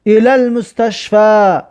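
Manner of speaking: slow, with المستشفی keeping its final a, ilal-mustashfa; teacher modelling الی المستشفی; slow Arabic demo. Shown as a demonstration. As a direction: read الی المستشفی slowly. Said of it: "یگان میده تغییر نیافت".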